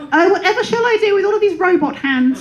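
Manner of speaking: put-on high, prim old-film lady voice; 1940s British film lady. Comic telling the crowd her robot-hands line, in an old-film lady voice. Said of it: "in the voice of a lady from a nineteen forties British film".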